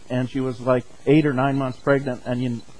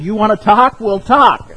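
loud, raised-pitch mock yell; retold screaming; 7 semitones higher, 6 dB louder than usual. Husband standing on the bed at his wife, re-enacted shouting. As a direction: re-enact yelling back in an argument. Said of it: "and I'm screaming".

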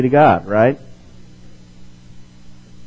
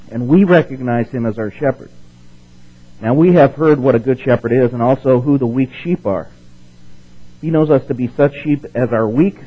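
louder, formal reading voice; reading a quote aloud; 6 dB louder than usual. Acted. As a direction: read Luther to the church. Reading Martin Luther quote.

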